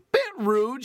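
high, loud mock-offended quip; mock indignant retort. Answering a classmate's jab, loud and mock-offended.

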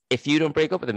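lightly voiced witchy mother; mock mother warning. Mother warning her daughter, mock ominous. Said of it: "she says".

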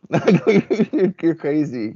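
mock astonishment at her hundred machines; amazed exclamation. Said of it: "And I'm like".

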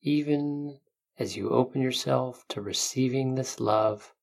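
calm guiding voice, unhurried; guided meditation. Acted.

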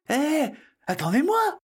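put-on very high child's call. Boy left behind in the tunnel, calling out high. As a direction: cry after them in a high little voice.

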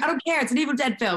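a pushy boss, voiced up high; high dismissive director voice. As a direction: brush off the objection, pitched high. Quoting the director's insistence.

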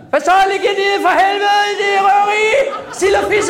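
put-on high, loud Bornholm robber accent. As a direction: bark it like a Bornholm bank robber. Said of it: "en motherfucker fra Rønne". (bornholmsk accent, højt og råbende)

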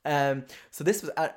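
normal talking voice

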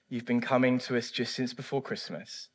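normal talking voice

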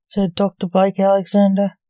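ordinary delivery, nothing marked

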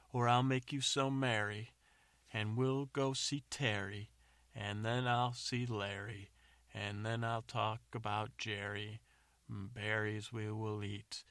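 loud, declaimed doggerel, stretched out; improvised bad rhyming. James rhyming at the beast that won't listen, loud declamation. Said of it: "he just starts rhyming".

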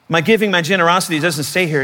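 normal talking voice

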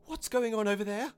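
high, loud questioning call; crying out. A narrator's reading. Onlooker in the gathering crowd, crying out. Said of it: "one voice cried".

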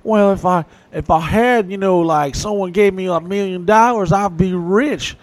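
mock the daydreamer who'd be rich; making excuses. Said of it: "we all make the same excuse".

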